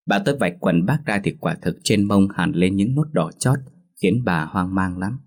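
usual speaking voice

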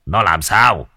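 a touch of panic; understated alarmed question; panicked question. A narrator's reading. Husband asking his crying wife, mildly panicked. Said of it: "hỏi vợ giọng hoảng hốt".

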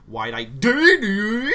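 high, loud, very slow whine; whiny confused voice. Retold or imitated. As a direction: whimper it, baffled. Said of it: "Yamcha's just all like".

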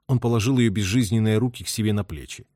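ordinary delivery

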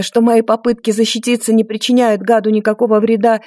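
plain reading voice

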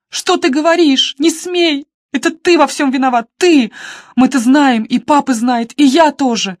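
a sister screaming blame at her brother; hysterical shouting. A narrator's reading. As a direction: scream the accusation at him.

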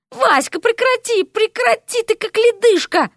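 high, pleading protest; squirming protest. Acted. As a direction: yelp at the icy frog to stop. Derek squirms from cold frog.

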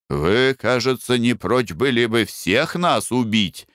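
usual speaking voice

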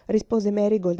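usual speaking voice

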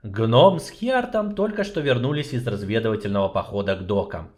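usual speaking voice

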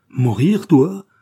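loud, rising cry of disbelief; acted maternal alarm, voice leaping up; shocked question. A narrator's reading. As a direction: burst out in horrified disbelief. Mother shocked at son's suicide threat.

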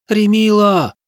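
put-on high, far-off girl's scream; shrill scream. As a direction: scream the name in panic. Girl screams name in battle.

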